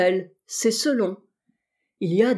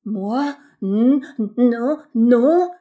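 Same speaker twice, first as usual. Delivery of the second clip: stammering in fear; 3 semitones higher, 6 dB louder, much slower than usual. A narrator's reading. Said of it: "réussis-je à articuler".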